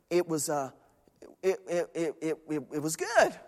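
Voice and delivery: imitating a flustered, halting reply; startled stammer. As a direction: stumble softly over the first word, as if caught off guard. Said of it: "He was startled".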